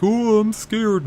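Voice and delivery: high, slow, mock-timid whimper; a hammed-up frightened squeak; mock scared. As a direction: play the coward sarcastically. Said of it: "Not like".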